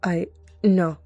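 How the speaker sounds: faltering, huffy denial; start, break off, then huff the no. A narrator's reading. Cat denying the she-cat's jab, huffy. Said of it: "they huffed".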